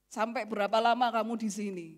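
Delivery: ordinary delivery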